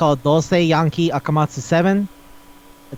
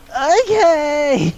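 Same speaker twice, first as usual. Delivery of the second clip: bewildered okay; 11 semitones higher than usual. Retold or imitated. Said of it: "And he's like".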